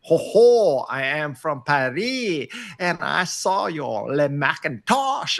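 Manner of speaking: slow, hammed-up French accent, imitating an audience member; a Frenchman asking a rambling question, in a mock French accent. Mocking a French questioner at a talk, hammed-up French accent. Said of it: "British accent or something, or French accent".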